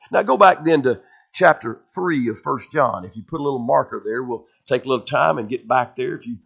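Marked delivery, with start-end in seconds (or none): none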